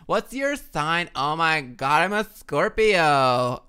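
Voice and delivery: put-on loud, slow, stretched Valley Girl squeal; Valley Girl accent. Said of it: "The Valley Girl accent".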